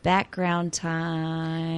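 slow announcer intro. Pretend. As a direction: announce it slowly. Segment announcement.

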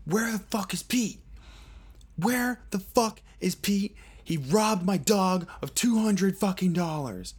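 high, frantic yell, dragging out the question; crazed yelling. Retold or imitated. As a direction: scream it like a wired junkie demanding answers. Crackhead screams demand at speaker.

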